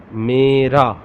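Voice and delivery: Mera said clearly as "meh-ra", tapped r; Urdu word. Shown as a demonstration. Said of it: "How to pronounce in Urdu?".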